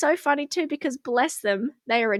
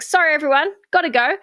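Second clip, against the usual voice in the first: hurried loud apology; 5 semitones higher, 4 dB louder, much faster than usual. Retold or imitated. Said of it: "was like".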